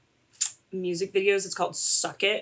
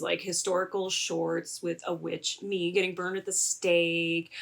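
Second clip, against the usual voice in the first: normal talking voice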